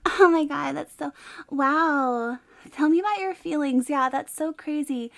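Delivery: put-on gushing valley girl therapist. Shown as a demonstration. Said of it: "I could do full valley voice".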